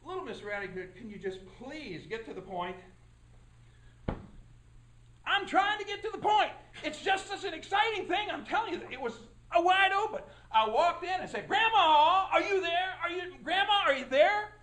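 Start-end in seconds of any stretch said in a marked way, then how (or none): 0.0-2.8 low, soft judge voice, impatient; a judge losing patience with the witness; low, quiet judge who wants her to get to the point; stern judge. Acted. Judge cuts into the story. 11.5-14.5 loud calling into the house; a girl calling for her grandmother, loudly. Retold or imitated.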